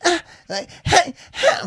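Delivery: high wheezy rapper voice; squeaky high rap ad-lib. Retold or imitated. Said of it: "sometimes [name] sounds like".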